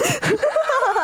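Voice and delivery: squealing high laugh; high burst of laughter. Real, not acted. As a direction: crack up laughing. Breaks up laughing mid bit.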